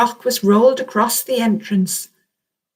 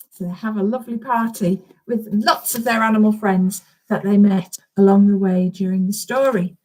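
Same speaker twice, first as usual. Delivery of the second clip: plain reading voice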